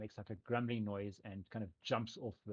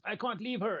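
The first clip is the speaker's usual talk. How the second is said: desperate cry; 11 semitones higher, 5 dB louder than usual. Acted. Character cries out before running in.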